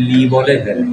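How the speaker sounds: ordinary talk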